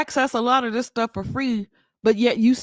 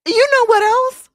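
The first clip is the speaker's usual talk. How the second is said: much higher and louder; excited switch; 11 semitones higher, 5 dB louder than usual. Real, not acted. Streamer to chat, starting a new rant excitedly. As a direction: bounce into the next point.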